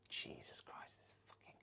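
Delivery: fed-up whispered curse; exasperated whisper. Retold or imitated. A partner cursing to themselves, whispered. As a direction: hiss it under your breath. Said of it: "as opposed to like".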